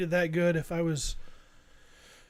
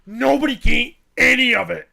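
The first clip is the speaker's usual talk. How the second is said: bossy shout; 3 semitones higher, 10 dB louder than usual. Retold or imitated. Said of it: "she's like".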